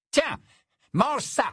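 high, brisk command; stern order. Acted. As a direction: order him about like a wayward pet. Worple orders the vampire to eat.

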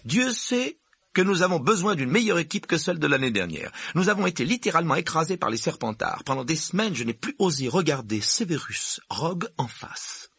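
high-pitched strict teacher, firm and proud; teacher presenting a gifted pupil, strict and pleased; stern professor McGonagall. Acted. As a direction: play the brisk headmistress type. McGonagall voice, higher and louder.

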